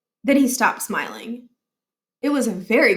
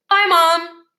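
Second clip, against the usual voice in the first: put-on higher, louder kid farewell; a boy calling bye to mom; young Miles; 8 semitones higher, 6 dB louder than usual. A narrator's reading. Miles leaving car.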